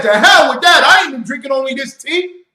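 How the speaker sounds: high, loud defiant yell; defiant colonists. Retold or imitated. Preacher acting the rebels' defiance, yelling high. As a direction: roar it like a rebel crowd. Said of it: "They said".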